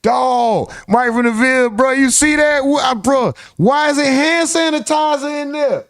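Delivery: high-pitched, baffled disbelief; incredulous reaction. Real, not acted. Baffled reaction to the played clip.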